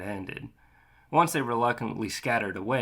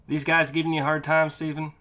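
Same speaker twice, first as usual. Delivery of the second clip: raised voice calling across the street; 6 semitones higher, 5 dB louder than usual. Acted. Narrator calls across the street to a trucker, loud call. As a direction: call it over to the truck driver across the road. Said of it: "I called out, a hand cupped".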